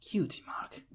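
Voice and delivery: soft puzzled whisper; whispered to himself. Acted. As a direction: whisper the strange words, testing them.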